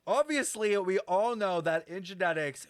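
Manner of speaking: mock lecture.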